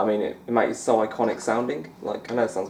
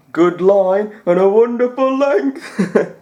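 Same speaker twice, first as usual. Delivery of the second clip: high, loud, very slow drawn-out pundit praise; John Virgo impression; 10 semitones higher, 8 dB louder, much slower than usual. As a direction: savour each word like a TV commentator admiring a shot. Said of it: "Commentator impressions".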